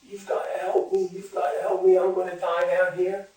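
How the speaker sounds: high, urgent plea for help; mock scream. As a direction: act out a desperate man calling up. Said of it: "The man starts screaming up".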